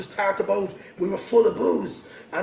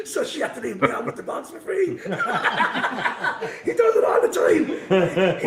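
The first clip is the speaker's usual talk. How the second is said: laughing through the punchline, rising higher; 9 semitones higher, 3 dB louder than usual. Real, not acted. Friend laughs telling how the giant dodges fees, laughing. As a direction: crack up while telling it.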